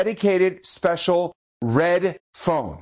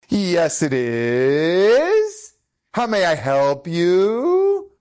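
slow, swooping phone-answering put-on; cheeky mock-official politeness; wise guy; 3 semitones higher, 3 dB louder, much slower than usual. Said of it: "being a little bit of a wise guy".

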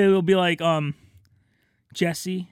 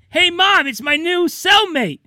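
cheerful son; 10 semitones higher, 6 dB louder than usual. Retold or imitated. Son greets mom in cell.

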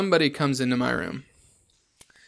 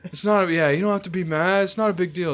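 put-on higher, louder placating drunk voice; imitating drunk friend; 6 semitones higher, 4 dB louder than usual. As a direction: mimic a drunk pal insisting all is fine. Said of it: "He's like".

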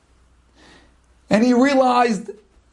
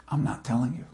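low, hushed and firm refusal; quiet defiance; 10 semitones lower, 11 dB quieter, faster than usual. Acted. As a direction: refuse calmly, almost under the breath. Rabbi defies armed thugs.

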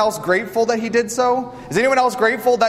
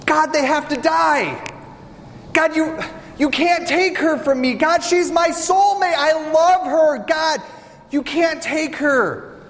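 desperate pleading; 7 semitones higher than usual. Acted. Adam begging God for Eve.

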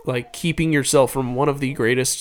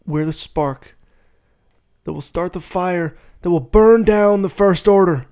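resistance pilot's speech, building louder and higher; Oscar Isaac as Poe Dameron; 5 semitones higher, 5 dB louder than usual. Acted. Quoting a film line on request, passable actor impression building to rousing. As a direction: start measured, then rally the troops forcefully. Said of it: "pretty passable Oscar Isaac".

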